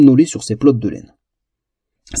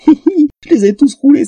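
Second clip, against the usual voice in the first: high, squeaky triumphant titter; gleeful giggling; 13 semitones higher, 4 dB louder than usual. A narrator's reading. Elf gloats alone in the toilet, giggling gleefully. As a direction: giggle through the boast. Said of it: "s'esclaffa-t-elle".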